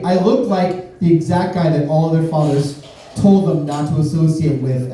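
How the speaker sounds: ordinary delivery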